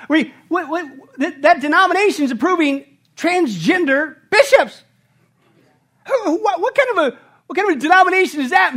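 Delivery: high, loud, fast stammering outrage; hammed-up flustered sputter; sputtering disbelief. Preacher mocking puzzled churchgoers, sputtering disbelief. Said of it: "We scratch our head".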